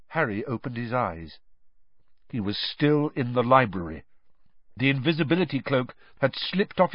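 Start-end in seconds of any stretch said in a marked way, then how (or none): none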